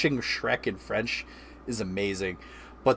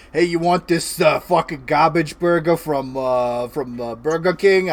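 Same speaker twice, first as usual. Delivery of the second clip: sleazy hustler voice, louder; scumbag voice; 3 semitones higher, 5 dB louder than usual. Retold or imitated. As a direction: push it like a shady mob guy. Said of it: "the voice I gotta default to anytime I'm talking about someone being a scumbag".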